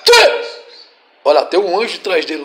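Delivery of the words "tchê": sharp, very high cry; shouted burst. Real, not acted. Preacher urging the angel's sword strike, shouting. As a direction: fire out one short high shout.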